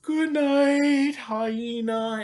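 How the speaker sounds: high cheery goodnight call; cheerful zookeeper. Pretend. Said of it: "and says".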